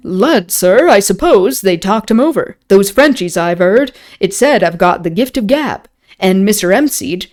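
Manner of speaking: hearty English dialect, dropped aitches; rustic English accent. Acted. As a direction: put on an old-time English tavern accent. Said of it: "make me speak in kind of an accent".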